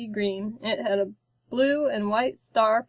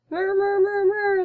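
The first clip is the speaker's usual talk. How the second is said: much higher little chirping murrs; chirping creature; 10 semitones higher than usual. A narrator's reading. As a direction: chirp it like a hungry hatchling. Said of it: "the hatchling chirped".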